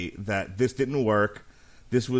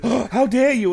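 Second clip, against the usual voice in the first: high, loud scandalized gasp; hammed-up shocked father scolding; mock outraged dad; 12 semitones higher, 7 dB louder than usual. Father scolding his kid for the rude remark, hammed-up outrage. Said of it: "He's like".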